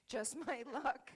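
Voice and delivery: breaking into laughter at the irony, drawn out; laughing. Retold or imitated. Retelling Kevin's shark fear to the panel, laughing loudly.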